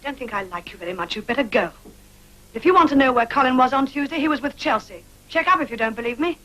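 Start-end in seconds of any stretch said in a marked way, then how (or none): 1.2-6.4 raised, heated voice, pitched up; loud, indignant retort; angry outburst. Acted. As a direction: throw it at them loudly, fed up with the questioning. Angry outburst ordering him out.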